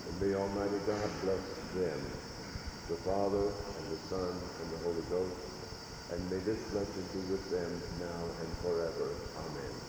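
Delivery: soft, quick murmured Lord's Prayer; hushed prayer. Real, not acted. Priest leading the kneeling congregation in a hushed prayer. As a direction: pray it quietly with the kneeling congregation.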